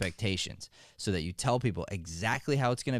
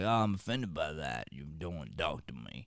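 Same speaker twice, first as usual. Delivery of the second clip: offended grandpa cowboy; 6 semitones lower, 5 dB quieter than usual. Retold or imitated. Mocking offended people, low soft mock whine. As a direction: lightly play a wounded old-timer. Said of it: "and they sound like that".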